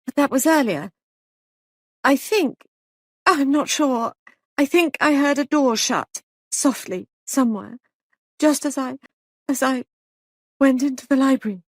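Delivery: high, frightened, faltering; a scared witness groping for memory; shaken. A narrator's reading. Molly telling the sergeant what she heard, high and frightened.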